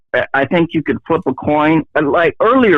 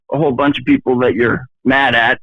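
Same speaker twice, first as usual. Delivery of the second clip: ordinary talk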